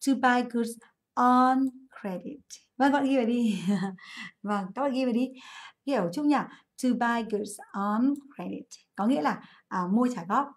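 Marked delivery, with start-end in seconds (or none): 0.1-2.4 slow, careful English model phrase. Shown as a demonstration. Teacher slowly models the phrase for students. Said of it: "So we say". 6.8-8.6 slow, deliberate English model sentence; slow model phrase. Shown as a demonstration. Teacher repeats the phrase slowly for note-taking. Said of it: "So we say".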